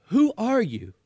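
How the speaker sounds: high, frightened question; Shasta, frightened. Acted. Boy calls into the dark to an unseen giant, frightened. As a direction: blurt it out in fright. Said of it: "scarcely above a whisper".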